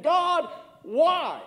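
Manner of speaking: high, pleading cry of grief; anguished plea, rising and slowing; retold anguished cry. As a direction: cry out to God like a grieving boy. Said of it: "crying hysterically and saying".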